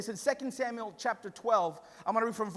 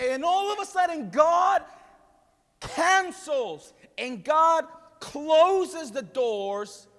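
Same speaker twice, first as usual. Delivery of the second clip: loud, then slow and drawn out on closes; shouted climax, then slowing down hard; rising shout; 5 semitones higher, 6 dB louder, slower than usual. Real, not acted. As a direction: preach it out, building to a shout. Preacher building to climax.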